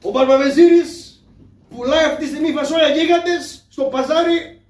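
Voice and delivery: loud, high crier's shout; town crier call. Acted. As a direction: announce it to the whole bazaar. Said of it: "θα το βγάλω το μεροκάματο".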